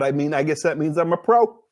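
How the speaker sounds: ordinary talk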